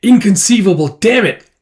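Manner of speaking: climbing from uneasy to a high, loud panic; growing panic. Acted. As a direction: let the disbelief build into a panicked curse. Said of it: "It's like".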